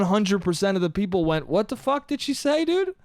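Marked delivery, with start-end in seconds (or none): 1.5-2.9 high, incredulous question climbing at the end; mock-bewildered crowd reaction, pitched up; baffled disbelief. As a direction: ask it totally lost, voice rising. Acted baffled crowd, pitch leaps.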